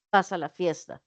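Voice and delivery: "Vas a la fiesta" stated low with a falling end; "Vas a la fiesta" with stress on "Vas", no rise; statement. Shown as a demonstration. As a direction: say "Vas a la fiesta" as a flat fact. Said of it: "Ven que estoy diciendo cosas diferentes".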